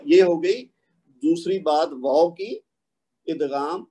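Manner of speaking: ordinary talk, nothing marked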